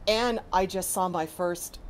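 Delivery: ordinary delivery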